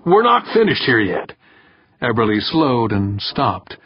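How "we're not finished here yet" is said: high-pitched call, mildly yelled. A narrator's reading. Director holding back the next candidate, calling out. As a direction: call it across a theater. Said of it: "Berkowitz yelled from the stage".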